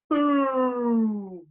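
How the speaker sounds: high, loud, long drawn-out boom; explosion boom. A narrator's reading. Bus exploding behind the children, loud drawn-out boom. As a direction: stretch it out like a blast dying away.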